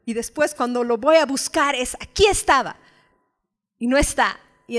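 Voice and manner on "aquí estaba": mock surprise. Searching for a lost book.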